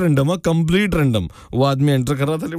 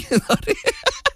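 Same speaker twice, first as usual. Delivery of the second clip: sudden high-pitched laugh; high burst of laughter; 3 dB quieter than usual. Real, not acted. Host laughs at serious remark. (اونچی قہقہہ نما ہنسی)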